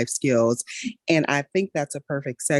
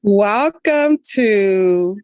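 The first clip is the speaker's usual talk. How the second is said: slow, louder announcer-style welcome; podcast intro; 4 semitones higher, 8 dB louder, much slower than usual. Acted. Host opening her healthcare podcast, slow announcer welcome. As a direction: draw out the opening like a show host.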